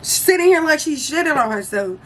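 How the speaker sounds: high exasperated rant; to the friends asking about her, high irritated retort. Retold or imitated. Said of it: "I'm irritated".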